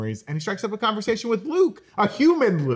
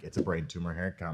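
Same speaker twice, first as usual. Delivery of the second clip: mock doom; 12 semitones lower, 11 dB quieter than usual. Mock doom about himself.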